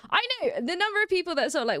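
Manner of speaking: usual speaking voice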